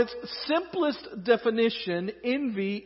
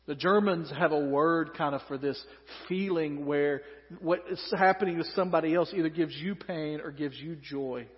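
usual speaking voice

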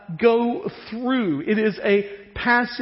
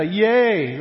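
louder, swooping yay; mock fan cheer; 4 dB louder than usual. Preacher imitating fans after an upset win, mock cheer. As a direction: cheer like the team just won. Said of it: "What the whole world yells".

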